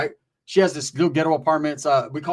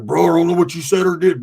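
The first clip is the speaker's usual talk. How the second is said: Roach's voice; 7 dB louder than usual. Retold or imitated. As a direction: play the angry friend on the phone. Roach voiced on phone call.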